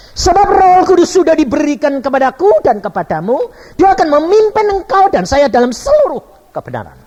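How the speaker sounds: high, loud, ringing proclamation; full-force preaching. Real, not acted. Preacher exhorts congregation to learn from Jesus, loud and high.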